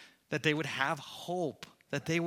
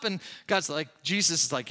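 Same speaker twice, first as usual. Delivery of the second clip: normal talking voice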